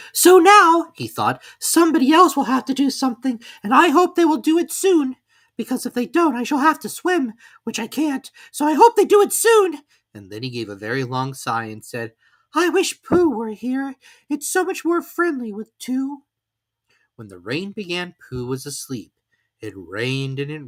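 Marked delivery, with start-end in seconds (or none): 0.1-0.9 small timid animal, much higher and louder; a tiny worried piglet wishing aloud. Acted. Piglet sighs to himself, stuck alone in the rain. 1.6-9.8 small timid animal, much higher and louder; a tiny worried piglet wishing aloud. Acted. Piglet sighs to himself, stuck alone in the rain. 12.6-16.2 small timid animal, much higher and louder; a tiny worried piglet wishing aloud. Acted. Piglet sighs to himself, stuck alone in the rain.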